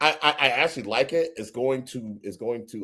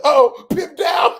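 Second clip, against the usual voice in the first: high, loud, drawn-out mock cry; imitated outcry; 9 dB louder, much slower than usual. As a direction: wail it out slowly, imitating the friend. Retelling friend's cry over dropped cake.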